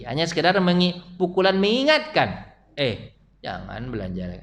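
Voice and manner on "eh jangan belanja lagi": gentle reproach. Shown as a demonstration. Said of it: "Mukulnya gini".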